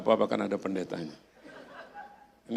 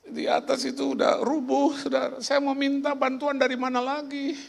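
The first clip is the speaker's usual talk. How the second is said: high, drawn-out pleading voice; memohon bantuan; 14 semitones higher, 3 dB louder than usual. Retold or imitated. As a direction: plead at a high, steady pitch like someone appealing to a crowd. Meniru orang yang minta bantuan. (memohon dengan suara tinggi)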